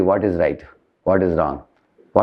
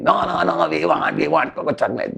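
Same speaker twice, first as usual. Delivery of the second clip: mock child tantrum; 8 semitones higher than usual. Children throwing a tantrum at their mother, high mock wail. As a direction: act out a kid refusing fruit, pitched way up but not shouted. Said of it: "They're screaming".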